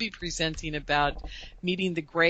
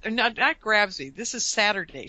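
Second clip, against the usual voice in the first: ordinary talk, nothing marked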